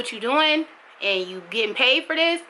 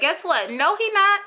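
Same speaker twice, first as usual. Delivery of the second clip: defiant retort; 9 semitones higher than usual. Real, not acted. Mother answering critics of her son, defiant and emphatic. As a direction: shut them down hard.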